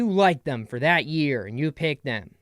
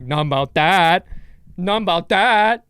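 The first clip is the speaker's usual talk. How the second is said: mock dismissal; 3 semitones higher, 8 dB louder than usual. Fan turning on [name], loud mock dismissal. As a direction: call it out loud, stretching the last word.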